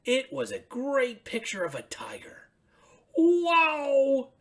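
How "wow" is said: high, bright puppet voice for Ernie; excited high Ernie calling out. Acted. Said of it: "said Ernie".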